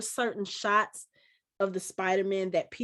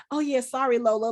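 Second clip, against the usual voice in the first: higher, louder mock apology; apologetic; 4 semitones higher, 5 dB louder, faster than usual. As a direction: act out an eager-to-please apology. Acts out Peter's hurried apology.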